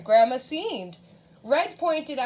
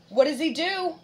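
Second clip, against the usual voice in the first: ordinary delivery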